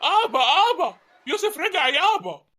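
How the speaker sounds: high, loud, laughing call to his father; joyful calling out. Acted. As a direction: burst in laughing with the good news. Son bursts in with news.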